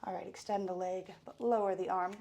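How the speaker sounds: loud, fast workout coach; fitness instructor. Acted. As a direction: pump up the class. Leads a workout warm-up.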